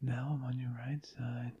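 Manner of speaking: soft whisper moving side to side; binaural. Shown as a demonstration. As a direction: whisper as if circling the listener. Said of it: "we can try going".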